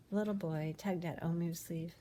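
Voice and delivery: ordinary delivery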